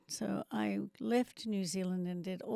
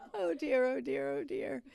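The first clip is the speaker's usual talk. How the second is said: laughing; 7 semitones higher than usual. Real, not acted. Laughing at her own long story.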